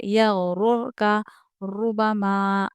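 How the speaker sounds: slow यगरुर with the ra in रुर full, not thin; heavy ra recitation. Shown as a demonstration. Teacher showing students a full heavy ra, slowly. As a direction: draw out यगरुर, ra said thick. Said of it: "रुर का बारीक नहीं पढ़ेंगे".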